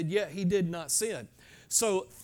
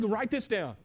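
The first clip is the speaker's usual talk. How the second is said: ordinary delivery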